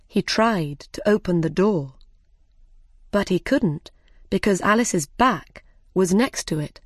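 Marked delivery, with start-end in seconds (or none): none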